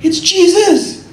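very high, slow, loud cry; excited recognition. Retold or imitated. Peter recognizing Jesus from the boat, high and slow in wonder.

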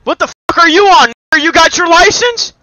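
high, loud furious rant; ranting shout at a player in the game; angry yelling. Real, not acted. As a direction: scream him down.

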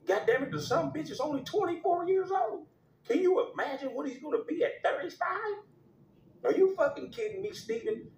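high-pitched ranting boss; shrill-pitched mock owner rant; mock angry owner. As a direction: squeal it out like a furious team owner. Skit voicing team owner yelling.

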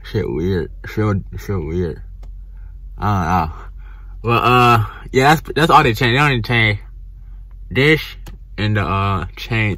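talking with lips stretched; fingers pulling lips. Real, not acted. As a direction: fingers in mouth showing braces. Seen 2: Fingers stretch lips apart showing braces; pauses near 6.1, resumes later.

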